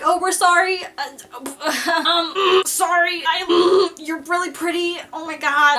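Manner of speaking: high, loud, babbling apology; girl flustered in front of her crush, babbling; flustered fangirl. Retold or imitated. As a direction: stammer out a starstruck sorry. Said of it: "That was my brain".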